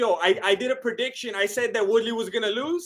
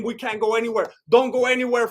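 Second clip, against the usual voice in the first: ordinary talk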